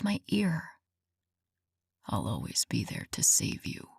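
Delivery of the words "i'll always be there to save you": low breathy whisper close to her ear. A narrator's reading. Man whispering into her ear in the bed, low whisper. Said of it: "he whispered".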